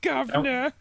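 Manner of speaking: high mock-British call; hammed-up British accent. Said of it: "I heard your British accent".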